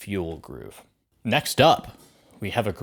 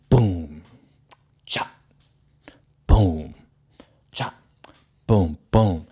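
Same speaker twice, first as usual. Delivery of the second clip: louder "boom" and "cha" drum sounds; vocal drum beat; 5 dB louder, slower than usual. Shown as a demonstration. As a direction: sing the groove with your mouth. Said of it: "add that second boom".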